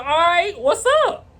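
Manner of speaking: high-pitched acted comeback; retold challenge. Gun owner answering the neighbor's threat, high acted challenge. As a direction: pitch it up sharply, imitating a face-off.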